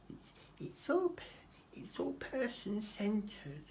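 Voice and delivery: very high, drawn-out silly voice; exaggerated social worker. Social worker excusing the system, in absurdly high mock voice. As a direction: push the caseworker parody right up high and slow. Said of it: "I don't know why I'm talking like that".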